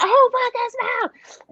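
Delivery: high-pitched mock exasperation; retold upset outburst. Mother retelling spilled milk while putting groceries away, high exasperated outburst. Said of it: "I got really upset".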